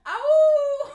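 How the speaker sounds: sudden high cry of pain; high playful yelp. Pretend. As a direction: yelp as the puppet. Puppet play.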